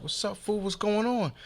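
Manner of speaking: imitated guy calling from a car; challenging greeting. Acted challenge from passing car.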